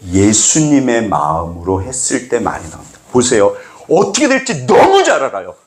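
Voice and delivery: normal talking voice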